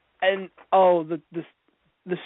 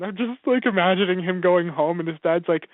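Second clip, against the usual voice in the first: usual speaking voice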